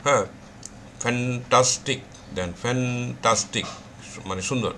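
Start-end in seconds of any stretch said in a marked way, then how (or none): 1.0-2.0 ফ্যান্টাস্টিক slowly in three parts, fan-tas-tik; split into syllables. Shown as a demonstration. Teacher showing three syllables, ফ্যান্টাস্টিক slowly split as fan-tas-tik. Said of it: "তিন syllable হইতে পারে". 2.6-3.7 ফ্যান্টাস্টিক drawn out as fan, tas, tik; split into syllables. Shown as a demonstration. Teacher repeating for students, ফ্যান্টাস্টিক slowly broken into three syllables. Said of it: "তাহলে তিনটা syllable".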